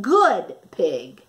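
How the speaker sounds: plain reading voice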